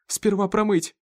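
high, urgent outburst; blurted out. A narrator's reading. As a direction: let it burst out in alarm. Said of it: "вырвалось у Сары". (вырвалось, встревоженно)

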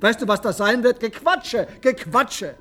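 mock the chatter with contempt; scornful mockery. Verspottet künftige Touristen. (höhnisch und viel höher)

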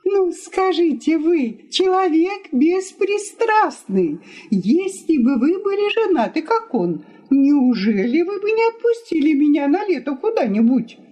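high-pitched wife pressing her case; aggrieved wife. Acted. Wife appeals to impartial guest, indignant.

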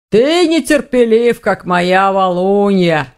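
slow, stretched-out orc growl; chiding orc. A narrator's reading. Orc scolding his impatient visitor, chiding.

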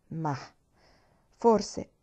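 usual speaking voice

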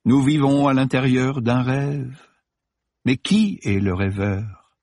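high, slow recitation; reciting. A narrator's reading. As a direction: intone it like a mantra. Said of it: "récita Delacroix".

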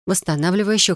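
ordinary delivery

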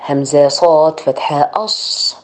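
usual speaking voice

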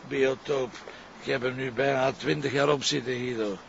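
ordinary talk, nothing marked

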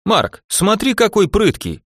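high, amused exclamation; excited. Acted. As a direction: call out to Mark in delight. Technician amused by patient.

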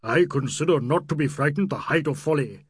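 loud, indignant character cry; rude old Nawab. A narrator's reading. Old Nawab rebuking the young people after the crash, rudely. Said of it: "cried the Nawab Bahadur quite rudely".

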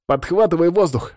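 urgent, high-pitched cry; exclaiming. A narrator's reading. Gorodetsky to Sanya as control slips, exclaiming. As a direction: call out for help urgently. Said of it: "воскликнул Городецкий".

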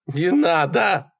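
slow, high-pitched whine; drawn-out pleading wail; whining plea. A narrator's reading. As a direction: whine it out in dread. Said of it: "заскулил Малькольм".

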